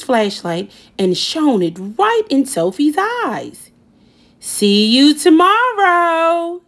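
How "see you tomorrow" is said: high, slow, louder singsong taunt; teasing boy. Acted. Wendell taunting Sophie at bedtime, high slow singsong. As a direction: stretch it out like a tease.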